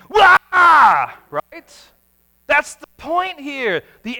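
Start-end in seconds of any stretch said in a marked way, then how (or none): 0.1-1.1 loud, high burst of fright; startled terrified shout. Pretend. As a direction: blast it out like sudden terror. Sudden burst of terror.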